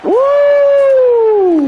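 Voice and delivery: high, loud celebratory woo; excited whoop. Real, not acted. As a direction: let out a big cheer. Celebrating a play in the game.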